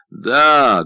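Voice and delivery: loud, booming single word; thunderous voice. A narrator's reading. Deaf painter answers his intruding friend in a thunderous voice. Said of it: "громовым голосом ответил Франсиско".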